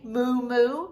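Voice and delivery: loud playful mock moo; hammed-up "Moo, moo"; cow moo imitation. Shown as a demonstration. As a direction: moo like a cow to tease the kids. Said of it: "Does a pig say".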